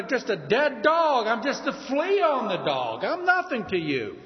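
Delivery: David pleading with Saul from a distance. Retold or imitated. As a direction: beg the king to see reason. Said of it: "he's reasoning with Saul and pleading with him".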